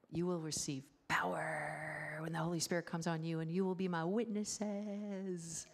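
mock-grand preacher voice with stretched endings; theatrical scripture quote; grand verse recitation. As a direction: declaim it, drawing out key words.

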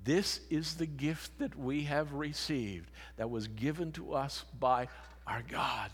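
ordinary talk, nothing marked

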